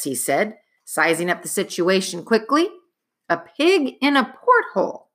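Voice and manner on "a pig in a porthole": desperate. A narrator's reading. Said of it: "asked Jack desperately".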